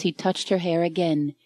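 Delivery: ordinary delivery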